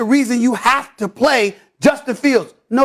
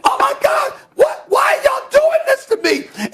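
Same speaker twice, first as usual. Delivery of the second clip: much higher, hammed-up cry of betrayal; mock shock. Host retelling his betrayal by the ladies, hammed-up shock. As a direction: act wounded and aghast, way up in pitch. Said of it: "And I was like".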